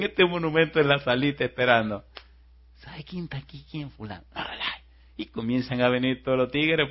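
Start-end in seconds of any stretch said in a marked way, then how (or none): 2.8-4.2 gossipy. Retold or imitated. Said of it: "los monitores son chismosos".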